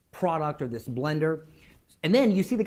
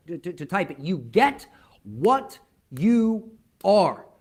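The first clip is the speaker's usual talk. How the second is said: high, loud, forceful rally speech; motivational preaching; 6 semitones higher, 5 dB louder than usual. Acted. Coach drilling an online audience on mindset, full-force preaching. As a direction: drive each question home to the crowd.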